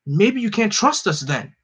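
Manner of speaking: ordinary delivery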